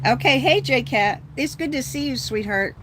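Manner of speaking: bright loud hello to a friend; happy greeting. Real, not acted. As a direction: welcome a friend with open glee.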